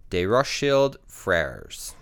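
slow, careful French accent. Shown as a demonstration. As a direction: say it the French way, slowly. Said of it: "Pardon my, uh, French accent".

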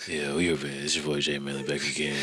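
low, soft late-night DJ, a bit creepy; quiet storm DJ voice. Pretend. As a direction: purr it like a late-night radio host. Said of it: "you sound creepy right here".

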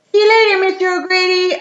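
high, loud sing-out farewell; mock cheery send-off. Policeman dropping Dan home, mock cheery send-off. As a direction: call goodbye in a put-on high voice.

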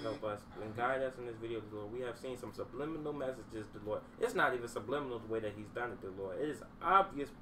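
hushed, soft solemn prayer; mock prayer. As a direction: pray over the video in a hush. Call on Jesus.

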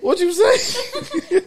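high, slow, laughing question. Real, not acted. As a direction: laugh through the words. Laughing at mic mixup.

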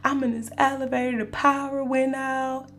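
all sad. Retold or imitated. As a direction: whimper to your mom for sympathy. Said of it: "all sad".

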